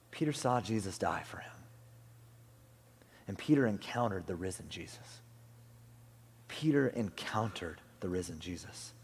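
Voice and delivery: low, soft and weighty; quiet reverence; hushed, solemn. Real, not acted. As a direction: let the voice drop almost to a whisper. Solemn answer after a question.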